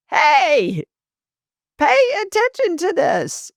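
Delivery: high-pitched urgent call of a spirit; mock scream. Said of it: "spirit's screaming at this point".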